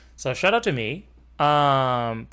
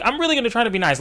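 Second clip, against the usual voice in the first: mock upbeat vow; 10 semitones higher, 4 dB louder, much faster than usual. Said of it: "'Cause I'm like".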